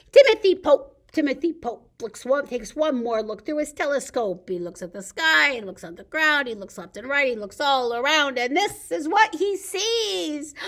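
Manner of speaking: loud, lively picture-book reading; storytime reading. Acted. Librarian reading Shark in the Park to kids, lively storytime voice. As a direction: read it big for the kids at storytime.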